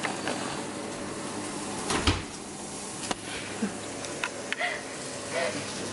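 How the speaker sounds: long airy giggle; breathy laugh. Real, not acted. Laugh alone on line.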